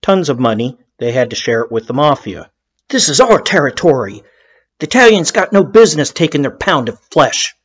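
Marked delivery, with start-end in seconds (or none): 2.9-7.6 lightly acted territorial grumble, a bit higher; grousing. Irish mob boss complaining about the Italians taking a cut, grousing. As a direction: imitate a mobster's indignant grousing. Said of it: "he groused".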